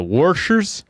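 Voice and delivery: "washers" said with an R, like "worshers"; imitated "washers" with an R before the sh; added R. Coworker copying the boss's speech, R put into "washers". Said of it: "is the way he said".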